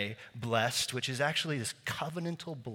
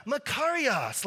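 high, drawn-out joyful cheer; exclaimed congratulations; 11 semitones higher, 3 dB louder, slower than usual. Acted. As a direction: congratulate a new mother in a much higher voice. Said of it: "You'd be like".

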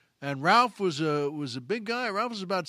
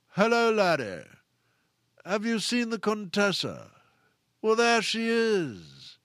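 Peter's theater voice; 5 semitones higher, slower than usual. Retold or imitated. Recalling an actor greeting a young colleague, booming theater voice. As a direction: boom it like a grand old English actor. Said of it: "I'll never forget Peter's voice".

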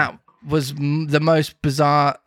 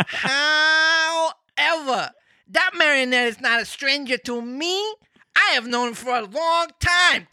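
a tiny insect judge, raised high and unhurried; talking cricket voice; 8 semitones higher than usual. Acted. Reading Pinocchio aloud, the cricket speaks in a high voice. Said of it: "that voice is too good".

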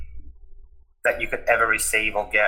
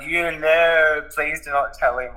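higher, drawn-out no; pleading; 6 semitones higher, slower than usual. Retold or imitated. Son begging mum not to tell dad, pleading. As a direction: beg in a mildly panicked retelling.

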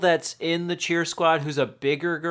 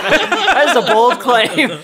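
slow words through laughter; laughing; 4 semitones higher, 6 dB louder, much slower than usual. Real, not acted. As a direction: laugh it out, drawn out. Laughing at a silly claim.

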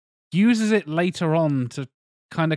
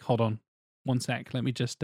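quiet aside; 4 semitones lower, 7 dB quieter than usual. Retold or imitated. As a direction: softly, hold on a sec. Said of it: "he's like".

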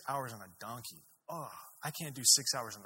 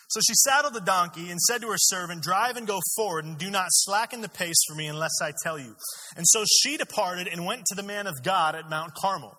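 loud, higher-pitched Bible reading; forceful scripture reading; 5 semitones higher, 8 dB louder than usual. A narrator's reading. Preacher reading the story to a congregation, forceful scripture reading. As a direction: read the passage out to the whole room.

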